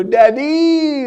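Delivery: high, drawn-out call; stretched high-pitched holler; demonstrated Daddy call. Retold or imitated. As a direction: mimic the required yell, high but not full volume. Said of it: "didn't stand up and go".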